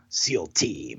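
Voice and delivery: low, booming TV-promo announcer. Shown as a demonstration. Showing his co-host how to say the show title, in a deep announcer voice. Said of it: "you gotta do it with an announcer voice".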